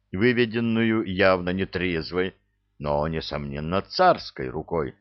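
ordinary delivery

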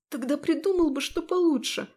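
high, squeezed squeak; strangled squeak. Acted. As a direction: try to growl but squeak. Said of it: "вышло лишь сдавленно пропищать".

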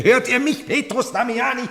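loud, high cry to a distant man; calling out. Acted. Heinrich calls out to the abbot Petrus Damiani, loud and high. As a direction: call across the hall, pitched high and loud.

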